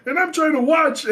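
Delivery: loud, high-pitched comeback; mock indignant yell. Retelling his retort to the angry filmer, mock-outraged yell. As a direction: throw the complaint right back, shouting. Acted comeback in retold quarrel.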